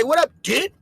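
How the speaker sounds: "What up, did?" with "did" for dude. Retold or imitated.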